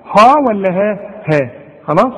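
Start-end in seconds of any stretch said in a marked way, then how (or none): 0.0-1.0 first "ها" thick like "haw", second thin like "hae"; heavy versus light ha. Shown as a demonstration. Teacher quizzing a student, contrasting heavy and light ha. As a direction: contrast the two "ها", dark then light, loudly. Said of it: "يبقى الهاء في القرآن".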